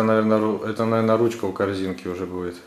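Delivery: ordinary delivery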